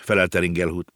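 usual speaking voice

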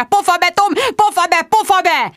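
high, frantic repeated yelling; screeched. A narrator's reading. Sharon tries to silence Tom on the machine, shrieking. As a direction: shriek at him to shut up. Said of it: "visította".

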